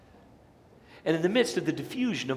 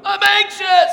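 much higher, shouted "anxious"; mock anxious shout; 13 dB louder, slower than usual. Preacher acting someone answering "how you doing", mock frantic shout. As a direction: blurt it out like nails on a chalkboard.

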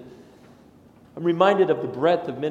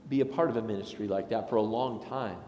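usual speaking voice

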